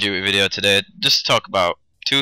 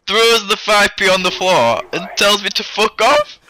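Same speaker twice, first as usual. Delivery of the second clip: high, slow, outraged retelling of the payoff; outraged telling; 11 semitones higher, 4 dB louder than usual. Real, not acted. Telling friends how the homeless man swore at him, high and slow. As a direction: stretch it out, voice shooting up in disbelief.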